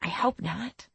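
soft, hushed reply; whisper. A narrator's reading. Narrator confides in the girl beside her, softly hushed. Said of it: "I whisper".

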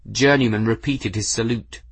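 ordinary delivery

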